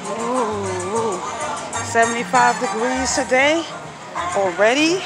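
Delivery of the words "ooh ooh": ordinary delivery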